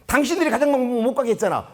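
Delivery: high, indignant accusation; retold angry retort. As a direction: throw the blame back, imitating an angry teacher. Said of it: "화를 내는 거예요". (화내며 따지는 말투 흉내, 높게)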